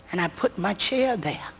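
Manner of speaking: ordinary delivery, nothing marked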